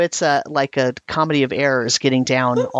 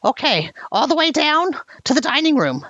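high-pitched grudging goblin; a goblin sulkily agreeing, voice up high; reluctant goblin; 10 semitones higher than usual. Acted.

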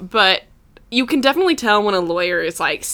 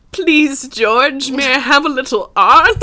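higher-pitched timid begging voice; mock pleading; 5 semitones higher, 3 dB louder, slower than usual. Fans asking George for permission, mock pleading. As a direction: plead like a meek little supplicant, hammed up.

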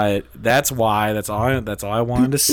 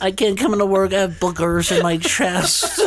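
mock complaint; 8 semitones higher than usual. Joking about going to work messy, hammed-up complaint. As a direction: ham up a silly high-pitched excuse.

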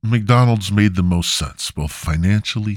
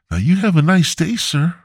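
much higher, sweet creepy farewell; eerie sweet cashier; 9 semitones higher than usual. Retold or imitated. Comic imitating the creepy cashier wishing him a nice day, high and sweet. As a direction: say it sweetly, like a friendly death stare. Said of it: "and she's like".

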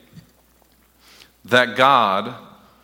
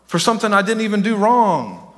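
indignant complaint; 7 semitones higher than usual. Pretend.

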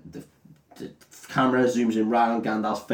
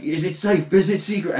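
mock aggressive repeat; 7 semitones higher than usual.

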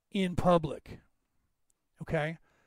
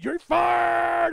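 much higher, louder wrestling-boss yell of "You're fired"; Vince McMahon shouting; 10 semitones higher, 4 dB louder than usual. Retold or imitated. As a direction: bellow "You're fired" like a heel boss firing everyone. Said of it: "he comes down and he's just".

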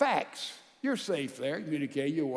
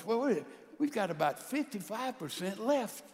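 ordinary talk, nothing marked

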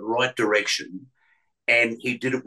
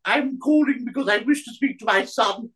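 much higher, hammed-up royal voice; mocking the Queen. Mocking the prank caller's fake Queen, high and plummy. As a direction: lampoon a bad Queen impression. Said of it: "like, you know".

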